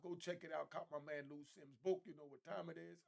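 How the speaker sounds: normal talking voice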